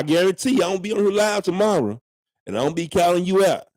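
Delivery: calling out. Real, not acted. Shouting out a viewer on live.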